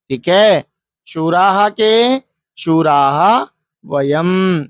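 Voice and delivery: slow, deliberate reading of the line; drawn-out reading. Shown as a demonstration. Teacher reading the verse line to the class: slow, drawn out. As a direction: stretch the words of the verse slowly. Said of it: "एक पदेन उत्तरतः".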